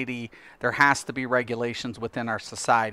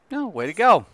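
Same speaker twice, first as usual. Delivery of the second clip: higher, louder mock cheer of pride; proud cheer; 7 semitones higher, 5 dB louder than usual. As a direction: cheer her on like a proud dad. Cheering his daughter's protest.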